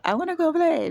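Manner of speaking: put-on mildly playful child voice; childlike. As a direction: ask to play like a small kid. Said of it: "like a child".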